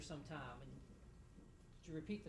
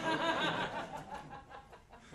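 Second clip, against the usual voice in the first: laugh; 8 semitones higher, 6 dB louder than usual. Real, not acted. Laughs about handcuffs.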